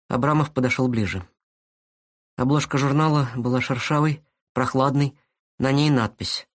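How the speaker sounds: usual speaking voice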